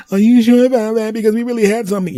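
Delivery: high, loud put-on woman's voice; pleading ex. Ex begging him to reconsider, high and loud. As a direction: beg dramatically.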